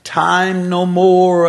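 loud, long-drawn proclamation; angel crying out. Acted. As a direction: stretch each word into a ringing cry. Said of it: "he cries out".

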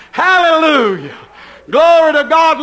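ordinary talk

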